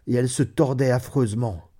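plain reading voice

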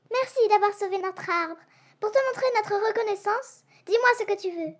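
high squeaky little bat; high grateful bat voice. Acted. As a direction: pitch it up like a tiny bat. Bat thanks Leslie.

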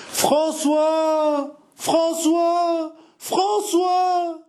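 very high, long cries after someone riding away; desperate call. Acted. A woman calling after the departing François, desperate high cries. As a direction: call out to him with all your despair. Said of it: "Ce triple appel désolé, enivré, haletant".

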